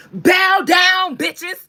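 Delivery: high, loud defiant call. Retold or imitated. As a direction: belt it out defiantly. [name] song quote.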